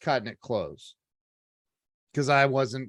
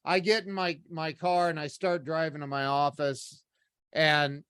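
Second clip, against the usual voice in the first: usual speaking voice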